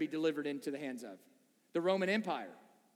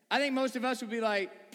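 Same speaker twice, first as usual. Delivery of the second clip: ordinary delivery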